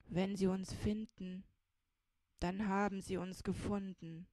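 lightly voiced stolid companion, falling tune; Jakob, resigned. A narrator's reading. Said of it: "flüsterte der langsame Jakob zurück".

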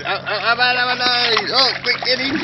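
high, loud, rapid-fire egging on; excited urging. Real, not acted. Man egging a dolphin on to jump, excited. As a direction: whip the animal up with rising excitement.

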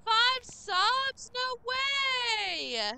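very high, loud, thrilled squeals; excited shriek. Real, not acted. Streamer thanks Control for five subs, shrieking excitedly. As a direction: scream with delight at the gift.

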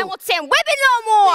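high, shrill-edged defiant shout; defiant cry. Acted. Mary defies her master, shouting high. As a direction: stand up to him at the top of your voice.

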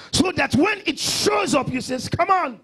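high, loud, rousing pulpit voice; full-force preaching. Real, not acted. Pastor citing Job's defiance of trouble, full-force preaching. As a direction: build to a ringing scripture quote.

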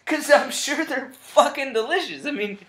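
loud rant. Real, not acted. Ranting alone about eggplant.

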